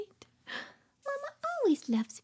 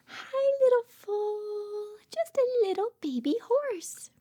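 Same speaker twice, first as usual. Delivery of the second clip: usual speaking voice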